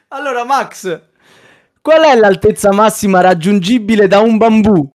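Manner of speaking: bright quiz host announcing a question; showy game show host. Acted. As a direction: ask it like a TV quizmaster.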